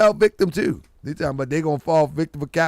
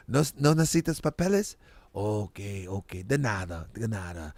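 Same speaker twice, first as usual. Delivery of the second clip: low, soft imitation of a Spanish-speaking clerk; subdued, deep-pitched mock clerk; clerk chatting in Spanish; 9 semitones lower, 7 dB quieter than usual. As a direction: play a low-key clerk talking with his brethren. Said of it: "he talked to his brethren".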